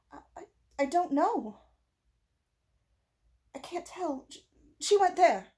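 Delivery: high, stammering, shaken answer; frightened stammer. A narrator's reading. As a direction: stumble over the words in fright.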